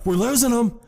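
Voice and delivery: hammed-up alarm over a dying man; mock panic. Retold captain panicking over victim.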